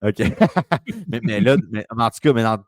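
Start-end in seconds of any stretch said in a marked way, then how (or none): 0.2-1.0 quick high laugh at the joke; host caught in his slip by the cohost, short laugh. Real, not acted.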